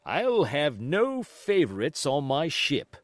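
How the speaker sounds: slow, deliberate and pointed; said loud so the boy hears; quite loudly. Acted. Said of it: "quite loudly".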